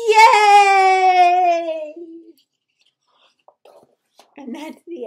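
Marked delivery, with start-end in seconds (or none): 0.1-2.0 loud, higher, joyful cheer; cheering shout. Acted. The animals applauding the little mouse king, a loud whoop. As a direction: whoop like the whole crowd.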